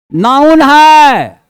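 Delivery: drawn-out, stressed है; slow checklist. Shown as a demonstration. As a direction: stretch out noun है as a checklist item. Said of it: "अब इसको कसते हैं". (धीमे, खींचकर जाँचते हुए)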